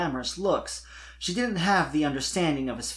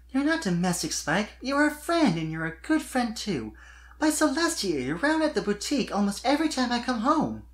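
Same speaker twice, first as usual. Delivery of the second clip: much higher giggly filly voice; Sweetie Belle voiced; 10 semitones higher than usual. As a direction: play a bright young unicorn filly, teasing kindly.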